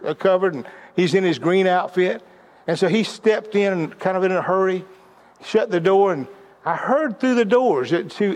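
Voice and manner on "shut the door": ordinary talk, nothing marked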